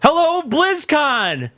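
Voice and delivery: high, very slow cheesy greeting; mock WoW announcer. Imagining next year's WoW reveal, high slow voice. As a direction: drawl it out like a stage host. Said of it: "next year and be like".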